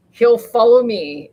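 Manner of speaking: usual speaking voice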